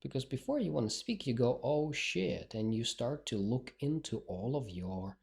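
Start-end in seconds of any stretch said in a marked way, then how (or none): none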